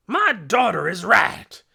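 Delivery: loud, high, slow angry shout. A narrator's reading. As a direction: back someone up at full volume. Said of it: "shouted Trog".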